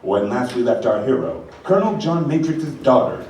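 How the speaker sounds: ordinary talk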